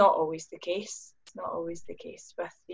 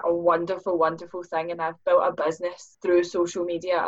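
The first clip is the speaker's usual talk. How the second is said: ordinary delivery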